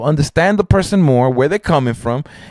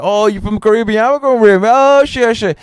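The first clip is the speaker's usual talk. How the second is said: higher pitched excited chatter; two friends bonding over Caribbean roots, higher; excited banter; 8 semitones higher than usual. Retold or imitated. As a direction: act thrilled to find common roots. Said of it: "we started off like".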